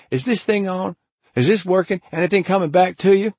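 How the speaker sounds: high, loud mocking questions; angry taunting yell. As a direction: yell it high, like testing a dead microphone. Taunting him while smashing his head.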